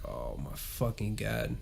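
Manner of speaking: low, drawn-out, fed-up groan; exasperated groan. Retold or imitated. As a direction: sigh it out like you're done. Exasperated retold reaction.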